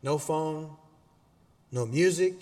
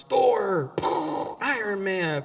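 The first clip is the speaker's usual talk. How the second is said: loud, higher mock kid yell; screaming kids; 6 semitones higher, 4 dB louder, much slower than usual. Preacher imitates his kids running around the house, screaming. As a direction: imitate kids yelling superhero names. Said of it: "running around screaming".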